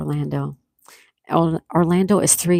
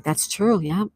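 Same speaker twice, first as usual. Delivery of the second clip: normal talking voice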